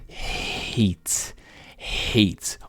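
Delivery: HATE with the vowel drawn out as "ay"; slow, careful model of HATE; long-A hate. Shown as a demonstration. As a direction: stretch HATE to sound like the letter A, silent final E. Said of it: "literalmente pronunciando o som do E".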